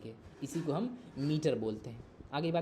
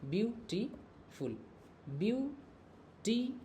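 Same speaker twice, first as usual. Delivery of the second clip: "Beautiful" very slow, higher, view... टी... फुल; split into three syllables; 6 semitones higher, 3 dB quieter, much slower than usual. Shown as a demonstration. Teacher showing students three pieces, "beautiful" slowly broken up. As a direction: pull "beautiful" apart with gaps between syllables. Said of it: "ये तीन टुकड़ा हुआ ना इसका".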